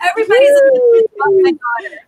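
high, loud, rushed through laughter; laughing outburst. Real, not acted. Mother who got everybody's attention by shouting at her daughter, laughing hard. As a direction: blurt it out fast, cracking up.

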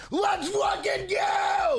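high, slow, stretched hype yell; all-out celebration shout. Real, not acted. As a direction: drag it out for the donor king. Hype for donor king.

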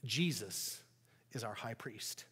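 quiet confident answer. Acted. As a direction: let the truth land gently.

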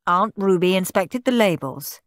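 ordinary delivery, nothing marked

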